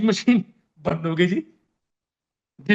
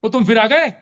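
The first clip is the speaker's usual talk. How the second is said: loud, higher call from inside; shouting at monkey; 3 semitones higher, 6 dB louder than usual. Retold or imitated. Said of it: "अब मैं अंदर से चिल्लाया". (बंदर को भगाने के लिए ज़ोर से चिल्लाना)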